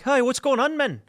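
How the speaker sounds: a light, high-pitched, breezy hello; casual greeting. Retold or imitated. A guy in Ireland lightly calling to cheering Syrians, high and breezy. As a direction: toss off a friendly, curious hey.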